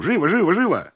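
high, insistent repeated order; urgent hurrying. A narrator's reading. Host hurries cousin to the inspector, urgently.

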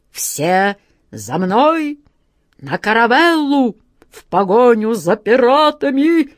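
put-on high, loud quavering old man's cry; rally them in a trembling old voice; shaky vibrating voice. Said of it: "дребезжащим голосом крикнул капитан".

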